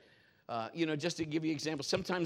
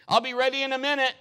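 breezy wife; 10 semitones higher, 10 dB louder, faster than usual. Retold or imitated. Wife voiced getting ready.

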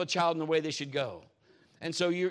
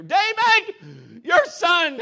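loud high yell of an exasperated mom; angry mom shouting for dad; 15 dB louder, much slower than usual. Retold or imitated. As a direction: holler the husband's name across the house, much higher. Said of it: "and I'd hear".

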